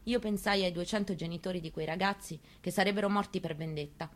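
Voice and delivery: normal talking voice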